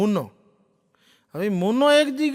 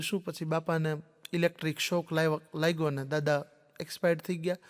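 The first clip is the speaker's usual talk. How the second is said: hushed; 6 semitones lower, 8 dB quieter than usual. Retold or imitated. Hushed guess about the death.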